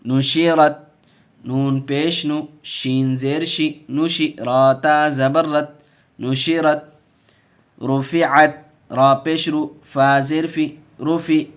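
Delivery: loud, slow syllable-by-syllable chanting of the letters; drawn-out classroom recitation; spelling-drill recitation. Shown as a demonstration. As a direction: call each letter and vowel out for the class to repeat. Teacher spells and recites drill words.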